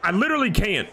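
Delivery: lightly mock a dramatic teen; teenage girl. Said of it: "I'm like a teenage girl at Starbucks".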